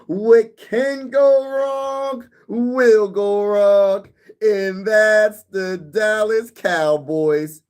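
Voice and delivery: high, very slow, drawn-out sports pundit proclamation; Stephen A. Smith. Retold or imitated. Mocking Cowboys fans on stream, high, slow Stephen A. Smith impression. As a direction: stretch every word like a TV hot-take host. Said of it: "shout out to Stephen A. Smith".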